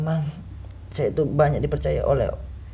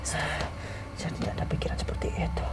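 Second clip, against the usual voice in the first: whispering; 10 dB quieter than usual. Acted. Possessed spirit denying in whisper.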